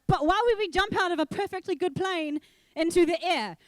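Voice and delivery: loud, high disbelieving question; mock alarm at jumping out of a good plane; incredulous. Questioning the skydiving instructor, loud and incredulous.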